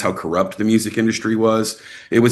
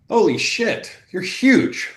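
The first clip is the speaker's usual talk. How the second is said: high-pitched mock astonishment; imitated amazement; 12 semitones higher, much slower than usual. People meeting a very tall actor, imitated amazement.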